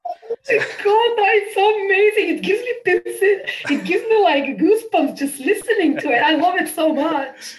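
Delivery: thrilled fan reaction; delighted. Real, not acted. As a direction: gush with real delight.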